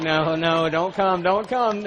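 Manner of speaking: loud half-laughing plea to hold back tears; begging yourself not to cry, hammed up; mock plea. Preacher retelling fighting off tears, loud mock plea.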